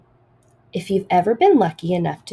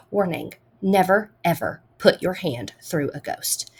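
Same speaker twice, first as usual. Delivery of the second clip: ordinary delivery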